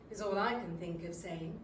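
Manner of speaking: plain reading voice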